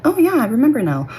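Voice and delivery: high-pitched mock realization; sudden recollection. Speaker imitating herself remembering blocked-out stuff, suddenly enlightened. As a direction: act out a memory popping back, pitch leaping up.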